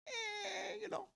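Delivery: high, drawn-out fake whimper; mock whimper. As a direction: whine it like a hurt puppy, slowly. Mock whimper about ten bucks.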